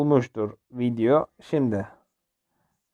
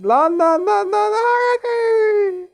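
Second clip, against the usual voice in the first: high, drawn-out wailing cry; panicked wail; much slower than usual. Real, not acted. Player cornered by enemies, wailing in panic. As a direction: wail the words in rising panic.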